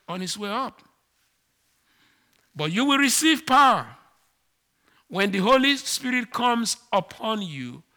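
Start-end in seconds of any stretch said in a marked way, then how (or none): 2.5-7.8 louder and higher, ringing out the promise; Jesus's promise to his disciples before going up, proclaimed out loud; proclaiming loudly. Acted. Preacher quoting Jesus, loud and raised. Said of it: "he said".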